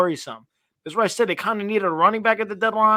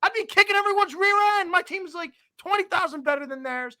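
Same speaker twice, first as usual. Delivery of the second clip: higher, incredulous retelling of the complaint; exasperated retold outburst; 12 semitones higher than usual. Retelling his outburst to the league commissioner, pitched-up exasperation. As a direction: replay the outrage at the league rules, pitched up. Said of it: "I'm like".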